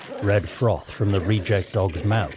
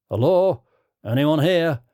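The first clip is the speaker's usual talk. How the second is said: louder, higher call into emptiness; 6 semitones higher, 4 dB louder than usual. Acted. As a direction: call into the empty van. Calling into empty camper van.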